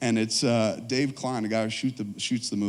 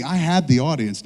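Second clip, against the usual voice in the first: usual speaking voice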